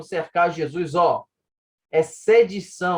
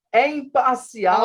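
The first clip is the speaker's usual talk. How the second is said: slow, higher theatrical declaiming; dramatic tone; 6 semitones higher, 4 dB louder, slower than usual. Shown as a demonstration. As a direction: stretch it out like a Greek tragedy line. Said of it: "Vamos fazer um tom dramático aqui".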